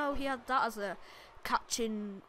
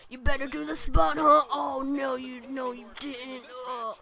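mock Triple H; 3 semitones higher, slower than usual. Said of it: "he's like".

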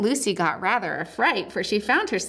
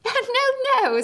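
laughing; 4 dB louder than usual. A narrator's reading. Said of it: "said Lucy, laughing".

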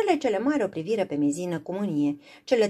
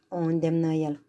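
usual speaking voice

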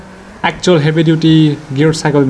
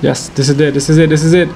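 ordinary delivery

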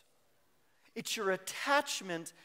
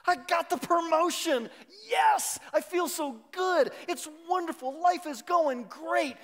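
elated; 9 semitones higher, 9 dB louder than usual. Retold or imitated. Mock elated promotion news.

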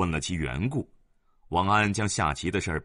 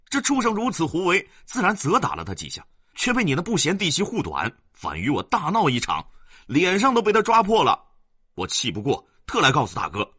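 put-on higher and louder, worked-up old man; aggrieved man flaring up; indignant outburst; 9 semitones higher, 4 dB louder than usual. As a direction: burst out over the scratched face. Zhang erupts about wife.

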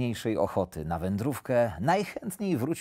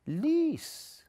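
put-on high, small childlike echo; high, naive pupil voice; little hare repeating; 4 dB quieter than usual. A narrator's reading. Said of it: "powtórzył zajączek".

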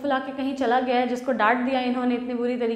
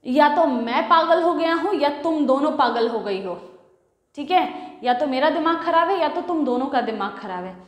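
exasperated husband; 3 semitones higher, 5 dB louder than usual. Acted. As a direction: throw up your hands at them both. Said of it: "George कहती है".

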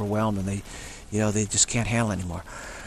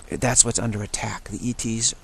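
ordinary talk, nothing marked

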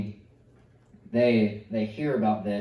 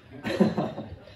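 laugh; 6 semitones higher than usual. Real, not acted. Laughs at own nosebleed.

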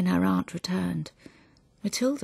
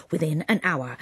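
plain reading voice

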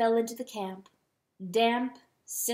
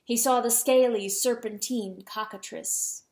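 usual speaking voice

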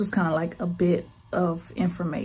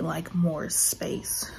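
whispering. Real, not acted. Breathless vlog whispers.

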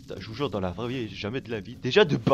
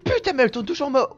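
high, loud, fast complaint; exasperated; 13 semitones higher, 7 dB louder, faster than usual. Real, not acted. Player fed up with the patrolling villain, exasperated. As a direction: rush it out in raised frustration.

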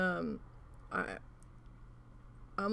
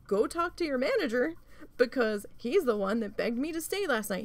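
raised, higher-pitched comeback; indignant retort; 5 semitones higher, 5 dB louder than usual. Retold or imitated. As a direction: push back in a retold argument. Retold argument with office manager.

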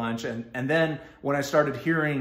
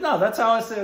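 mock insistence; 9 semitones higher, 7 dB louder, faster than usual. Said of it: "He's like".

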